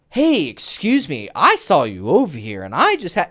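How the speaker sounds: imitating a hammed-up opener, swooping pitch; overacting. Coach mimicking client overdoing his approach to a girl, overacted. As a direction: stretch it out with exaggerated feeling.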